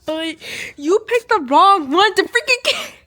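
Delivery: loud, high outburst, cut short; outraged yell. Real, not acted. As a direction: yell at the book in disbelief. Outraged reaction to a character's death.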